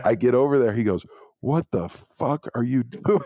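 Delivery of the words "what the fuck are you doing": higher-pitched mock outrage, breaking into a laugh; retold angry coach. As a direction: imitate an incensed coach, amused. Retold coach dressing him down.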